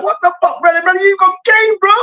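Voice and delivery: high, incredulous shout at a retiring star; disbelieving outcry. Retold or imitated. Fan crying out at Cantona for quitting too early, high disbelief.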